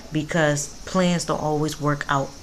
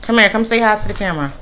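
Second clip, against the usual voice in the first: calling son over; 5 semitones higher, 7 dB louder, faster than usual. Real, not acted. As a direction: call him over, a bit higher.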